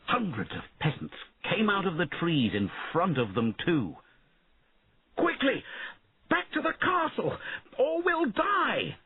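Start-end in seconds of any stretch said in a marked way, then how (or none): 5.2-9.0 desperate shout. A narrator's reading. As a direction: call the retreat in panic. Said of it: "shouted Einan".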